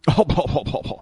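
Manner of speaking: put-on "oh-ho-ho" society laugh; mock-haughty chuckle. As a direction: chuckle pompously in the over-proper character. Caps the mock-refined answer.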